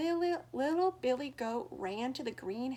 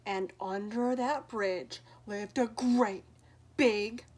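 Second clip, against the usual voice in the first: louder, dramatic, slow on ugly troll; storyteller build-up; 7 dB louder, slower than usual. A narrator's reading. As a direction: build the troll up to sound scary.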